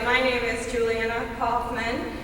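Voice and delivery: ordinary talk, nothing marked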